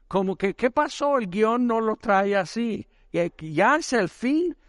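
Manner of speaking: high-pitched disbelieving protest; high, rising questions in mock alarm; alarmed demon. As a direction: imitate a demon caught off guard.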